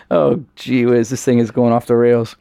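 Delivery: usual speaking voice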